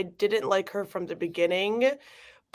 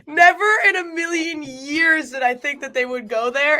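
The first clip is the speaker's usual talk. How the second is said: disbelieving outcry; 6 semitones higher, 5 dB louder than usual. Real, not acted. Outburst over uncomfortable plot twist.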